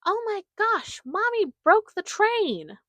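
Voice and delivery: put-on high, slow child's shout, carrying across the carriage. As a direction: shout it like an excited little girl. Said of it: "And Ava shouts".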